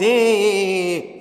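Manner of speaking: drawn-out noises mocking British speech; mocking British accent. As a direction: mimic a British speaker's long-winded babble. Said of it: "speaking in that fast British accent".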